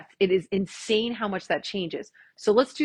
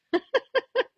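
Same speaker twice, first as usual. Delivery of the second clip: squealing high laugh at herself; delighted high laugh; high giggle. Real, not acted. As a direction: burst into a giggle. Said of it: "I love it, tree".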